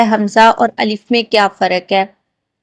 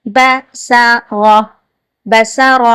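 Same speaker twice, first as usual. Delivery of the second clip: slow then normal; much slower than usual. Shown as a demonstration. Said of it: "practice".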